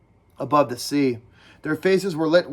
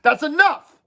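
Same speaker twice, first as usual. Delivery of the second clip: sharp; 12 semitones higher, 5 dB louder than usual. Acted. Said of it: "said Ralph sharply".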